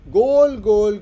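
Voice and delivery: गोल shouted high and loud, hammered three times; emphatic teacher shout. Shown as a demonstration. Teacher hammering the meaning to students, loud shout. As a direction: bang गोल out like a chant. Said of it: "गोल बात करना".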